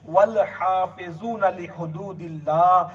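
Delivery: slow, measured Arabic recitation; Quran recitation. Acted. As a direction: intone the verse slowly and reverently. Quotes Quranic verse in sermon. (آہستہ، ٹھہر ٹھہر کر تلاوت)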